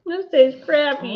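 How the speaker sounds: high, slow disgusted groan; after bitter medicine. Real, not acted. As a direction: grimace through a nasty aftertaste. Disgusted reaction to bad candy.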